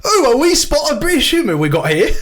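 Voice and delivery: high, hammed-up British accent. Host introducing British humour to co-host, badly faked British accent. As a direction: put on a chirpy, overdone British accent. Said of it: "my British, British accent".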